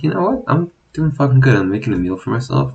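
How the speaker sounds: high, excited pep talk to himself, quickening at the end; proud inner cheer. Retold or imitated. Cooking a meal, he hypes himself up in an excited high voice. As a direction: cheer yourself on while cooking. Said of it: "and I'm like".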